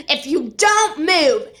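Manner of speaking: each word spaced out slowly; slow stern warning. Retold or imitated. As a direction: imitate someone spelling out a warning, word by word. Woman's stern warning, retold.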